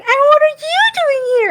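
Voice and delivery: high, loud, sing-song glee; too-happy Bojack line. Pretend. Imitating Bojack's too-cheerful line, high and loud. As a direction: gush it out like a failing actor. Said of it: "Imagine if Mia literally goes like".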